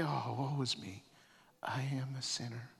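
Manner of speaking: sinking to a soft, whispered admission; hushed confession. Acted. Isaiah before God's throne, whispering his confession. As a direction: breathe it out like a guilty secret.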